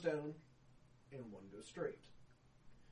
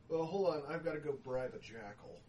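ordinary talk, nothing marked

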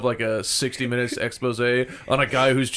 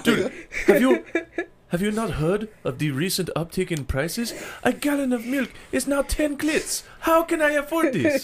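high, exaggerated African accent; mock African uncle, pitched up; 9 semitones higher than usual. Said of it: "I do the same African accent for any country".

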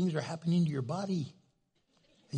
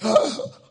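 shocked gasp; 5 semitones higher, 9 dB louder than usual. Retold or imitated. Parent shocked by a word.

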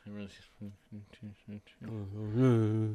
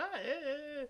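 lightly voiced high kid's yeah; a small boy's sheepish yeahs; imitating the boy; 9 dB quieter, much faster than usual. Mimicking the son caught overhearing, lightly. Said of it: "still sitting there going".

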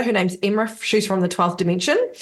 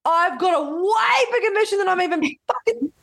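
excited disbelief; 9 semitones higher, slower than usual. Retold or imitated. Retelling her own excited reaction.

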